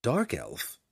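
bewildered echo of the question. A narrator's reading. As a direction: repeat the words in puzzlement. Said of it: "even more confused".

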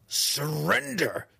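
high, slow, commanding demand. A narrator's reading. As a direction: bark the order. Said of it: "she demanded".

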